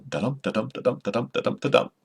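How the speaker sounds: soft "da-dum" pulse, weak "da", strong "dum"; iambic beat. Shown as a demonstration. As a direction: tap out "da-dum" quietly and evenly, like a heartbeat. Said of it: "The I Am, I A M B".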